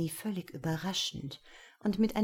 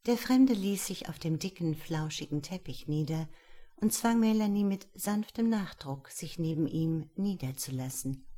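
ordinary delivery

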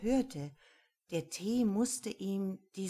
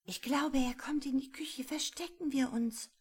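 high, hushed and urgent; excited whisper; 8 semitones higher than usual. A narrator's reading. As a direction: whisper fast, afraid of being heard. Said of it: "Rosmarie war ganz aufgeregt".

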